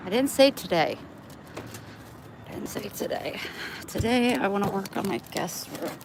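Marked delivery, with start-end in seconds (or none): 2.5-3.4 breathy whisper, no voice; whispered repeat. Shown as a demonstration. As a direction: whisper it back to yourself. Repeats line, then whispers it.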